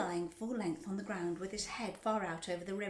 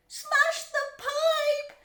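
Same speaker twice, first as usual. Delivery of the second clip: high, slow, full-force yell; 13 dB louder, much slower than usual. A narrator's reading. Mother wanting her stuck son freed, yelling. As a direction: yell it long and piercingly. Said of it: "yelled Missus Gloop".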